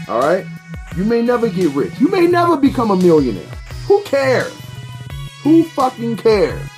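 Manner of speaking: normal talking voice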